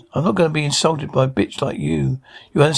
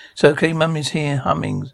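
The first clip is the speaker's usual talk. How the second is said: ordinary delivery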